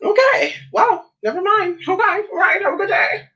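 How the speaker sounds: very high, squeaky backpedalling voice; the guy who got turned down, mocked in a squeaky high voice; mock flustered man. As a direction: mimic him retreating in a prissy high pitch. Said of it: "he was like".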